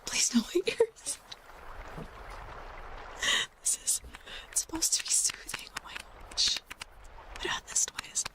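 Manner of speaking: usual speaking voice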